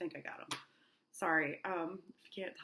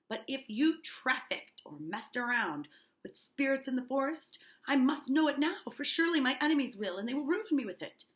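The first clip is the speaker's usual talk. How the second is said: raised, higher voice, building in force; agitated demand; 6 semitones higher, 5 dB louder than usual. Acted. Uncle confronting niece about spirits, agitated raised voice. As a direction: act out an uncle fearing ruin.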